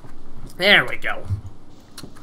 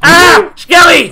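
high, loud, drawn-out yell; alarmed shout; 10 semitones higher, 10 dB louder, much slower than usual. Real, not acted. Skeleton attacks in the game.

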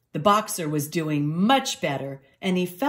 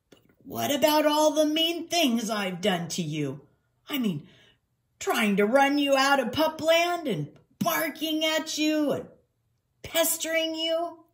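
high, puzzled, guilty questioning; incredulous boxer dog; 10 semitones higher than usual. Acted. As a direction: list misdeeds in rising disbelief. Voices Ned, incredulous.

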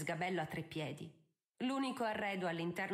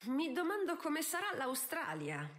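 relentless taunting; 7 semitones higher than usual. Acted. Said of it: "la tormentò implacabile l'altra".

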